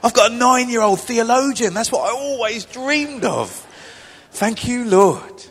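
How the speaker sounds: loud, high joyful cry to the Lord; delighted exclamation. Retold or imitated. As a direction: shout it out, overjoyed dad. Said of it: "And I'm going".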